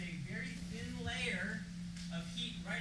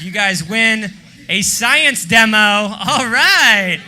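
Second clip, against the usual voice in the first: game show shout; 2 semitones higher, 24 dB louder, much slower than usual. Real, not acted. Announcing a prize to audience.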